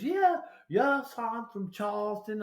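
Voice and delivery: high-pitched imitation of a Charleston accent. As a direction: mimic her old Southern Charleston accent. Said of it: "as soon as I heard her accent".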